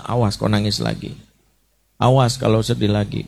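a low, imitated warning; scolding parent. Parent warning a crying child, a hint of sternness. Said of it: "orang tua seringkali mentreatment dengan bahasa".

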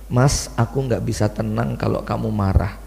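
soft, humble plea to an angry husband; tender plea. Retold or imitated. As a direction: soothe him, quietly. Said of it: "langsung segera dicium tangan suaminya". (istri membujuk suami yang marah dengan suara lembut)

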